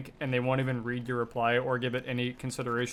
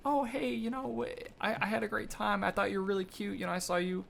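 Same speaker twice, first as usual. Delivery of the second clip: imitated nice guy; 3 semitones higher, 6 dB quieter than usual. Voicing a guy messaging a girl from class, softly nice. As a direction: ease in gently, trying to sound harmless. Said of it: "he's being nice about it".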